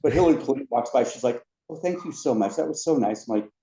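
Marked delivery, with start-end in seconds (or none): 1.7-3.3 gracious guest. Retold or imitated. First lady thanks the ride host, lightly imitated gracious thanks. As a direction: lightly voice a grateful visitor.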